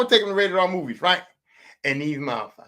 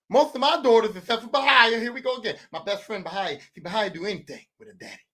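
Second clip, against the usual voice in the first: usual speaking voice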